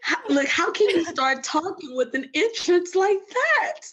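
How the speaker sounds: high-pitched, laughing through the question; laughing disbelief. Real, not acted. Guest overwhelmed by her introduction, laughing. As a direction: ask it while breaking into real laughter.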